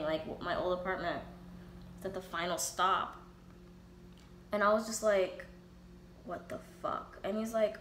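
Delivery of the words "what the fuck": breathy whispered disbelief; half-whispered stunned aside; whispered what the fuck. Retold or imitated. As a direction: hiss it under your breath in shock. Reaction at the final stop.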